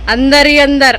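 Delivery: words broken by laughter; laughing while talking. Real, not acted. (हँसते हँसते बोलना)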